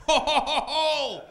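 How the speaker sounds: loud, high fake ho ho laugh; hammed-up laugh at his own joke; mock hearty laugh. Comic laughing at his own band pun, loud hammed-up ho ho.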